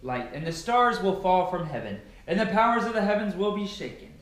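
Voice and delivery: ordinary delivery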